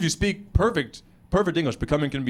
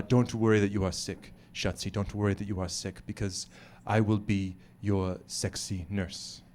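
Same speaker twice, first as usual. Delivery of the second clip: put-on low, soft, accented seductive voice; hushed, lower-pitched tender reassurance; soothing wife; 7 semitones lower, 7 dB quieter than usual. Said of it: "she was trying to say".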